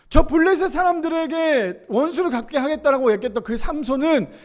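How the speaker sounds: ordinary delivery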